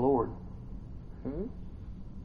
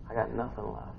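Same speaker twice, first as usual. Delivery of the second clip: very soft, worn-out admission; barely voiced, quiet resignation; spent and defeated; 3 semitones lower, 11 dB quieter than usual. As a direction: say it with the energy drained. Voicing someone worn out.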